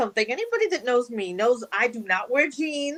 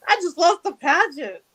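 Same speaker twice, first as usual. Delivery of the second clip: exasperated; 8 semitones higher than usual. Retold or imitated. Retorting to a producer's offer.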